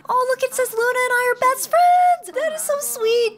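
high, squealing delight; real excited coo. Real, not acted. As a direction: gush over how sweet it is. Delighted by best friends.

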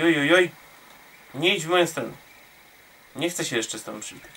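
normal talking voice

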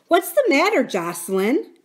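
high, loud startled question; alarmed. A narrator's reading. Aunt Polly rushing in after her husband's yell, alarmed.

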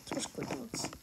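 soft whisper to the dogs. Real, not acted. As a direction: breathe it gently to the pets. Praising his dogs softly.

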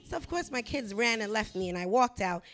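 usual speaking voice

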